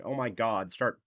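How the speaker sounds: ordinary talk, nothing marked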